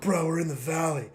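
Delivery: lazy stoner bro voice. Pretend. Stoner echoes the line.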